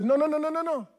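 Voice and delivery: high, quick repeated no; stopping him short. Retold or imitated. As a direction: cut him off firmly with no. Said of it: "and I stopped him".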